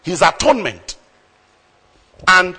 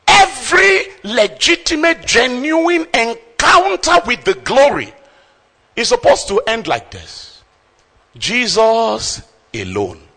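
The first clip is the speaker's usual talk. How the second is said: much higher and louder, proclaiming; impassioned preaching; 7 semitones higher, 4 dB louder than usual. Real, not acted. Preacher proclaiming to the congregation, impassioned and loud. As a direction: preach it with fire. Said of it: "preaching at full force".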